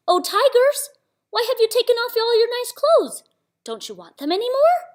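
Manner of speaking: very high, loud call across a distance. A narrator's reading. Little Samba calling out to the fighting tigers, high loud shout. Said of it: "jumped up and called".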